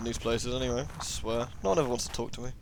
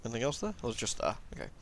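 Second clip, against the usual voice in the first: ordinary delivery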